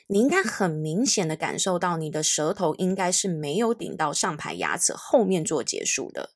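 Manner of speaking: ordinary delivery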